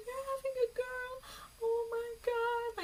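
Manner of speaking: high-pitched wail of joy, stretched at the end; imitated happy crying. Own mom cries over the gender reveal, mock joyful wail. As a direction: mimic an overjoyed mom wailing through tears. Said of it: "she's crying and crying".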